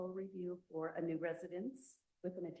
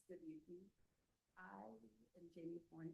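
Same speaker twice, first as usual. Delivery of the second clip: too quiet; 13 dB quieter than usual. Real, not acted. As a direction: introduce yourself too softly to be heard. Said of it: "Can you speak up, please?".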